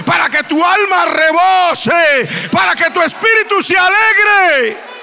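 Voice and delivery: high, loud preaching shout that keeps climbing; fiery preaching. Acted. Pastor preaching to his congregation, high, loud, fervent shouting. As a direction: preach it full force to the congregation.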